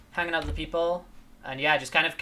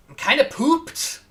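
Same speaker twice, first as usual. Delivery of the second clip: silly high voice; 10 semitones higher, 8 dB louder than usual. Pretend. Repeats tired line in put-on voice.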